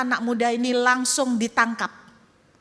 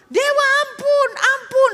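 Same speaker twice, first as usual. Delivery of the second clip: frightened begging; 14 semitones higher, 8 dB louder than usual. Acted. Tribe begging the "god.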